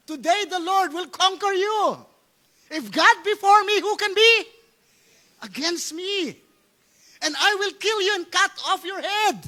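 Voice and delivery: high, loud battle declaration; David's defiant confrontation. Acted. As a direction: hurl it at the enemy, pitched high. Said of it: "Kinonfront niya".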